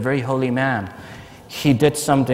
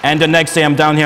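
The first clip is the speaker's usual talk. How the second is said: ordinary talk, nothing marked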